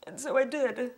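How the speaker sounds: high, tearful voice with long halting pauses; really fighting back tears while telling it; choked up. Real, not acted. Choked up recalling the moment.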